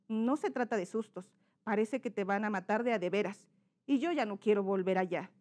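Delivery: low, subdued reluctant reply; second character's intonation. Shown as a demonstration. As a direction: refuse quietly, voice dropped. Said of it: "son dos personajes". (respuesta baja y renuente del hijo)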